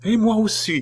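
high, eager woman's voice; excited companion. Acted. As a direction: chime in eagerly. A companion joins.

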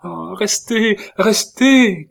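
pleading, building to a high, loud cry; desperate cry. A narrator's reading. Catherine begging Isidore not to leave, high desperate cry. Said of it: "cria Catherine".

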